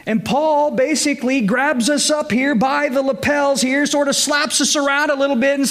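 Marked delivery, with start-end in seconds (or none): none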